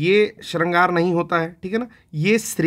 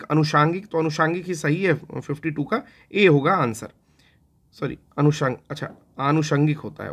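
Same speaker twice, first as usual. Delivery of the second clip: ordinary talk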